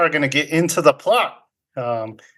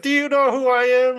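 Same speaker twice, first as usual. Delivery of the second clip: much higher, huffy self-important voice; indignant patron; 11 semitones higher than usual. Retold or imitated. Guest turned away by Rick, high and affronted. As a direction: play the snooty guest refused at the door. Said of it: "And that guy's like".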